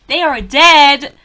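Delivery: slow, loud insistence on each word; loud, drawn-out exasperated correction; emphatic shout. Real, not acted. Repeats the point with force.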